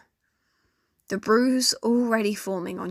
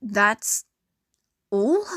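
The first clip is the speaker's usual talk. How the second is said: rising disbelief on all; incredulous echo; 3 dB louder than usual. A narrator's reading. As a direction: throw the words back in disbelief. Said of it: "Caitlin looked pissed".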